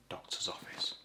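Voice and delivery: voiceless trailing off; whispered. Shown as a demonstration. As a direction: let it fade to a whisper.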